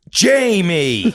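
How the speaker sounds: high, drawn-out motherly cry; mock outraged mum wailing at her son; exasperated mother. Mother scolding her son over his message, exasperated. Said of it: "And she'll be like".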